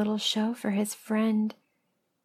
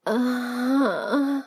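drawn-out voiced moan; loud moan; 3 semitones higher than usual. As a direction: moan it out in a long wavering note. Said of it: "I moan out loud".

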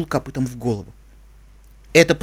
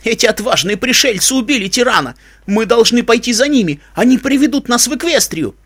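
high, raised-voice exhortation; impassioned call to the people; rallying cry; 7 semitones higher, 3 dB louder than usual. A narrator's reading. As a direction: rouse the crowd to follow. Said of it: "крикнул Лок".

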